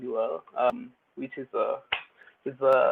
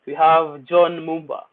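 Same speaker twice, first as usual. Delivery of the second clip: emcee name announcement; 6 dB louder than usual. Real, not acted. MC introducing the poet.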